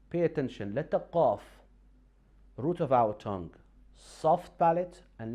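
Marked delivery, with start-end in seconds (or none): none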